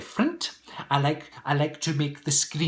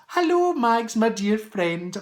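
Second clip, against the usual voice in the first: high, loud hello, then slow and fond; delighted hello to a dear friend; excited greeting; 7 semitones higher, 4 dB louder, slower than usual. Real, not acted. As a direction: greet Max like family. Greeting a viewer by name.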